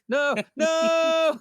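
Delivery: high, loud, drawn-out mock protest; mock horror. Joking with friends not to attack him, mock-horrified shout. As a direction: wail a joking no at full voice.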